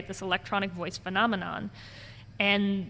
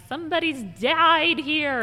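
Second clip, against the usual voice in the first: high, loud hammed-up psychic; mock-dramatic psychic revelation; terrible psychic; 8 semitones higher, 7 dB louder than usual. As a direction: proclaim it like a fake medium. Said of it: "it was a terrible psychic".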